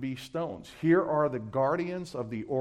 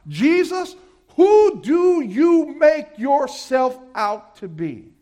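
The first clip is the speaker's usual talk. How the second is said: high, slow, shouted demand; challenging shout; 10 semitones higher, 10 dB louder, slower than usual. Acted. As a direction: hurl the question at him.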